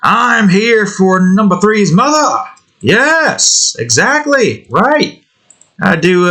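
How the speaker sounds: a lightly hammed-up punchline; mock-serious joke voice. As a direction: ham up the joke. Joking bit about number three.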